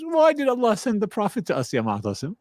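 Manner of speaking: imitating the sheikh. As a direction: ask like a gently probing teacher. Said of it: "He goes".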